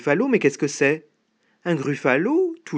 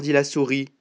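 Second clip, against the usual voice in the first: ordinary delivery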